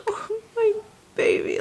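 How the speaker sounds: put-on high, crying "oh my baby"; mother meeting her newborn, tearful and high. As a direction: let your voice break with joy. First sight of her newborn.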